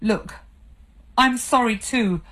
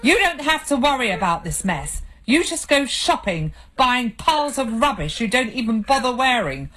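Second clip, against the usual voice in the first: angry tirade; 5 semitones higher than usual. Acted. Husband berating his crying wife, angry and bitter. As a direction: lay into her, bitter and angry.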